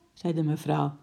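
plain reading voice

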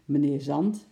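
plain reading voice

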